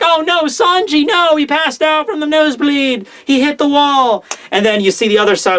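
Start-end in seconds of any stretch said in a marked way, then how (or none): 0.0-4.2 high, put-on tearful wail; mock crying. Pudding crying as Sanji passes out, high mock wail. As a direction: fake a distressed bride's lament. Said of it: "and she's crying".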